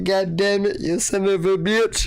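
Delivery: high-pitched hammed-up sobbing curse; mock crying. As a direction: whine it like you're crying at work. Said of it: "I was at work crying".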